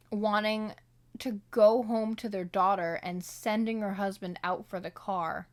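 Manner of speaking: ordinary talk, nothing marked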